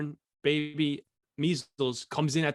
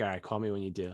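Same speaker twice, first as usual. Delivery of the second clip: low and soft; soft, lower brother hanging up; quiet brush-off; 7 semitones lower, 8 dB quieter, faster than usual. Retold or imitated. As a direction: mutter it dismissively. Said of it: "He's like".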